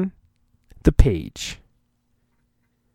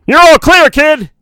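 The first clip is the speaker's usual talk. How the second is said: high, loud shouted call; 11 semitones higher, 6 dB louder than usual. Acted. Han shouting to Luke as the Falcon swoops in, loud shout. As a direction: yell it out to the kid. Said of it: "shouts Han".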